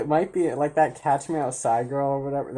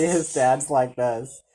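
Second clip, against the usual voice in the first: ordinary talk, nothing marked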